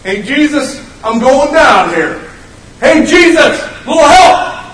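high, loud hammed-up holler; comically loud, drawn-out appeal; mock call for help. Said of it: "I don't think it was like".

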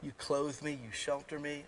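lightly voiced grateful dog; quiet adoring dog; devoted dog voice. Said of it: "a dog will say".

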